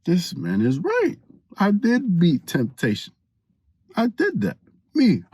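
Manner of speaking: high-pitched, puffed-up realization; mock self-congratulation. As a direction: marvel at yourself, hammed up. Acted proud thought after temptation's flattery.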